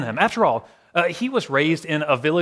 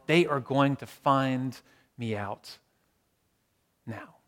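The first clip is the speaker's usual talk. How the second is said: drawn out word by word, sinking away; slow it right down, fading to almost nothing; slow, sinking dread; 4 semitones lower, 5 dB quieter, slower than usual. Acted. Preacher repeating the imagined fear of exposure, slow and hushed.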